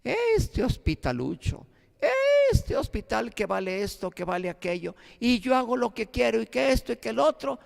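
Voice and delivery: high-pitched mimicry of an indignant complainer; imitating a woman's indignant tirade; mock ranting yell. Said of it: "siguió gritando".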